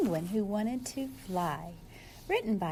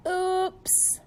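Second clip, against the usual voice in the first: oops; 8 semitones higher, 3 dB louder than usual. Acted. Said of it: "said Flip Flop".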